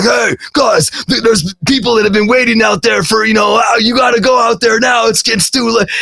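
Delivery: herd them out like a stressed handler; urgent call. Retold or imitated. Big Val telling the band fans are waiting, urgent shout. Security man hurries band out.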